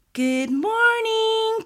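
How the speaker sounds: high, drawn-out "Good morning" call; singsong call. A narrator's reading. Mama calling the children to breakfast, singsong calling. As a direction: call out brightly, stretching the words. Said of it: "called Mama".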